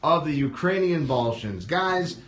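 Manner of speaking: big, drawn-out emcee delivery; show announcer. Real, not acted. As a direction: announce the act with a stretched-out name. Host announces act and show.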